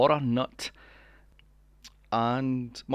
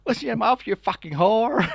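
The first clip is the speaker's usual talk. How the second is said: mock angry yell; 8 semitones higher, 7 dB louder than usual. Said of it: "It's like".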